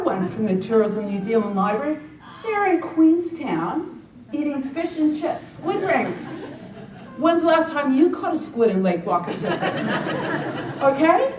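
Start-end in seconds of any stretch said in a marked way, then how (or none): 2.4-11.3 laughing disbelief. Real, not acted. Laughing at the advertising photo.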